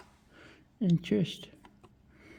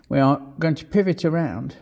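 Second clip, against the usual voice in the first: ordinary delivery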